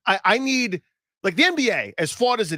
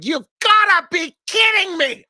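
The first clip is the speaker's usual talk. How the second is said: outraged shout, much higher and louder, slow; losing it; 11 semitones higher, 6 dB louder, slower than usual. Retold or imitated. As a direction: yell it like a commentator who snapped. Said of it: "Like, losing it".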